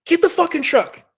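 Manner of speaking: high, loud urgent yell; screaming order. Retold or imitated. Injured rider screaming at his sister for the truck. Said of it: "I'm, like, screaming at my sister".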